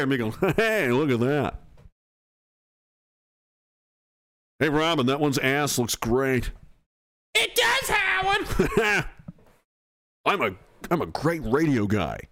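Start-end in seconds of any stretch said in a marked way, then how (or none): 0.6-6.5 lightly voiced radio host; a hint of a shock-jock DJ; radio guy voice. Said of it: "I just do typical radio guy voice". 7.3-8.5 high sidekick voice. Pretend. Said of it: "sidekick voice".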